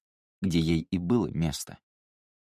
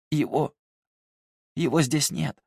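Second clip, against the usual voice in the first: hoarse gasp; 8 semitones higher than usual. Acted. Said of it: "хрипло выдохнула Талия".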